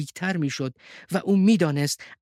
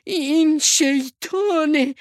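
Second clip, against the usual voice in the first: high, slow, loud gasp of fear; frightened; 14 semitones higher, 5 dB louder, much slower than usual. A narrator's reading. Mother sheep takes it for the devil, frightened cry. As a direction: stretch the words out in fright. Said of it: "مادر هیلو با ترس گفت".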